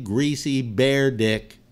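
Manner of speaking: ordinary talk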